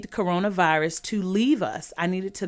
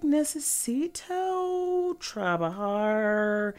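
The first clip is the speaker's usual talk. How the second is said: slow, drawn-out classroom Spanish reading; mock a teen sounding out Spanish word by word; stilted school Spanish; 4 dB quieter, much slower than usual. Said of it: "She'd be like".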